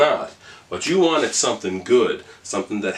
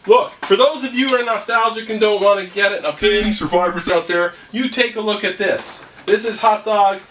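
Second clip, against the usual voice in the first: high, loud, pushy TV-sales voice; infomercial pitchman; 9 semitones higher, 3 dB louder than usual. Acted. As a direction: sell it like a late-night ad. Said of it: "you sound like a mad infomercial".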